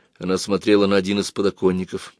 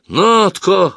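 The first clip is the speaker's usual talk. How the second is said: high, loud shocked exclamation; ахнул; 11 semitones higher, 7 dB louder than usual. A narrator's reading. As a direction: gasp the name out in shock. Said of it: "ахнул Слава". (потрясённое громкое восклицание)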